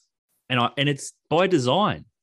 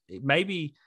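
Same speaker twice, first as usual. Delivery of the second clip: usual speaking voice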